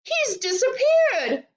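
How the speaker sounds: high, drawn-out wail; slow, high-pitched panicked yell. A narrator's reading. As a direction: wail it in horror. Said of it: "yelled Missus Globe".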